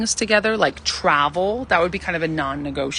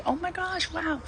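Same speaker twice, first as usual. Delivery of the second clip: high, soft, airy oh my gosh; soft breathless high awe; girly delight; 10 semitones higher, 10 dB quieter than usual. Shown as a demonstration. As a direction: show wide-eyed feminine wonder. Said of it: "that's feminine energy".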